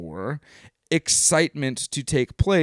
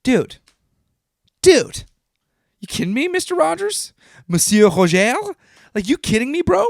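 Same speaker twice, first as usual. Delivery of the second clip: high, loud stunned "Dude"; overwhelmed disbelief; 12 semitones higher, 6 dB louder than usual. Real, not acted. Vlogger reacting to Mister Rogers' wisdom in high excited disbelief. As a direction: squeak it out like you can't believe it.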